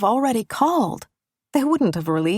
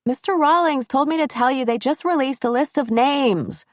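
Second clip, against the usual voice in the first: student yelling; 6 semitones higher than usual. A narrator's reading. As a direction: blurt it out at the top of her voice. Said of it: "She screams the words into my office".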